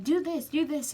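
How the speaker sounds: urgent insistence. Retold or imitated. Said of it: "it was like".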